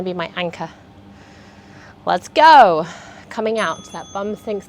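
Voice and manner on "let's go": energetic shouted start cue; loud cue. Real, not acted. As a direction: call Let's go. out to kick off the set.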